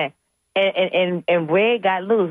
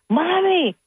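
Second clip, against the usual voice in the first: high mock scream of Mommy; retold scream; 11 semitones higher than usual. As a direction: daughter calls out to her mom, a high screamed Mommy. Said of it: "My daughter screamed".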